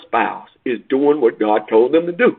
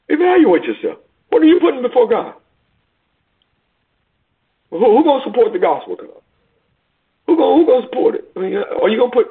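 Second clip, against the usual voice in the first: full-force preaching; 12 semitones higher than usual. Acted. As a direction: call the flock out, pitched way up.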